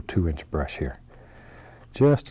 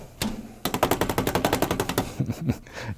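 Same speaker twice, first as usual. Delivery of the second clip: quiet chuckle under breath; soft breathy laugh; 4 semitones higher, 4 dB quieter than usual. Real, not acted. Laugh while beating brush.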